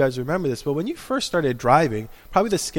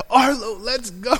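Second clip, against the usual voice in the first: impatient parent; 8 semitones higher, 5 dB louder than usual. Retold or imitated. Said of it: "I'm like".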